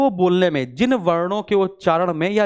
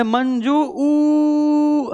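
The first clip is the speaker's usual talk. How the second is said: stretched vowel; 8 semitones higher, much slower than usual. Shown as a demonstration. Teacher demonstrating a pluta vowel, a long high call. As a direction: hold the ऊ long and high, as if calling. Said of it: "यानी ऊ को खींचा है".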